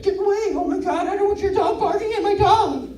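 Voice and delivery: high, fussy, shooing voice; annoyed dog owner. Retold or imitated. Dog owner shooing away a barking dog, high and fussy. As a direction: shoo the other dog away in a fluster. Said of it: "the other person is like".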